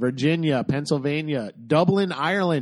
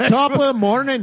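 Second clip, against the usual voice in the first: put-on loud, much higher laughing Irish accent; stupid Irish accent; 10 semitones higher, 7 dB louder than usual. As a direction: belt out a silly fake Irish greeting. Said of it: "when I do my stupid accent".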